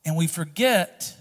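loud, high, fervent preaching; preacher at full cry; full-force preaching. Acted. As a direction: drive it home to the congregation.

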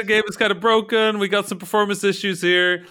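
hammy dev shrugging off bugs; playing a game developer. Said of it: "they're being game developers".

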